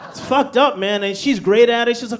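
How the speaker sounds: normal talking voice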